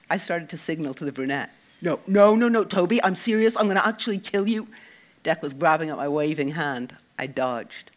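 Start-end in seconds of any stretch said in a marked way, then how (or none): 1.8-4.7 panicked protest. Acted. Dec panicked stopping signal.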